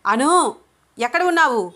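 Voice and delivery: high, full-voiced yell; loud shout. A narrator's reading. Father shouting for his daughter through the house, loud. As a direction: call out loudly, searching. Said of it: "గట్టిగా అరుస్తున్నాడు చంద్రకాంత్".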